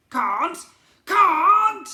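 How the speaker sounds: high, very loud, outraged goblin shriek. A narrator's reading. Goblin prince told no, high outraged shriek. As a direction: shriek it in disbelief.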